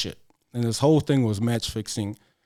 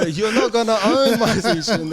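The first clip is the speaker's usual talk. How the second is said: high, louder defiant mock retort; 8 semitones higher, 4 dB louder than usual. Telling the officials off, mock defiant. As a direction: toss it back at them, daring.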